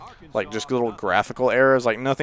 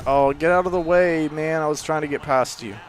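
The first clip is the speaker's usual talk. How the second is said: higher-pitched frustrated complaint; exasperated; 5 semitones higher than usual. Real, not acted. Gamer scolds his blocker in the game, exasperated. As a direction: protest at the player blocking you.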